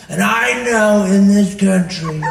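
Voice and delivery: loud, slow, drawn-out speechmaking; Donald Trump. Acted. President giving a televised speech, slow loud voice. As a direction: stretch each word out loudly in a weird presidential voice. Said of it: "Trump was the weirdest voice".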